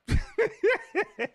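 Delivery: a sudden high cackle of laughter; high laughing squeal. Real, not acted.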